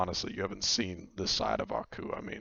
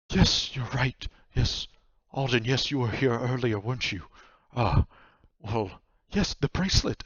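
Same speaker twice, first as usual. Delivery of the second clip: raised, louder voice of dawning memory; flustered nightmare, higher and halting; recollecting nightmare; 5 semitones higher, 5 dB louder than usual. Acted. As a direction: agree eagerly as it comes back to you. Nightmare remembers the bracelet.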